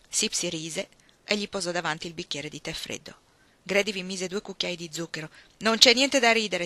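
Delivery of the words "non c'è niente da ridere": louder, higher scolding; irritated rebuke. A narrator's reading. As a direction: scold someone for laughing. Grady rebukes laughing Sipsy.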